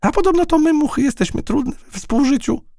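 very high little fly voice; buzzing fly. Acted. Fly complains about flies' bad reputation, very high. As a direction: speak up high as a cheeky fly. Fly continues teasing in character.

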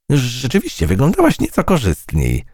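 high, teasing fly voice. A narrator's reading. Fly mocking the butterfly's looks, high and snide. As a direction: needle her sweetly, up high. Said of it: "powiedziała mucha".

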